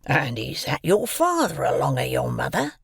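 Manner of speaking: put-on loom over the boy and growl it; menacing convict. A narrator's reading.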